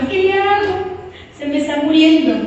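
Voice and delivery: high, pleading mother's cry; a desperate mother begging a neighbour for help; frantic mother. Retold or imitated. Mother begging the neighbour to save her child, high and pleading. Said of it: "Ella llorando, lágrimas".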